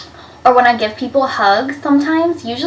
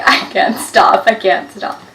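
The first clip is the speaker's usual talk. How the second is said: laugh crying. Retold or imitated. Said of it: "I'm just laughing".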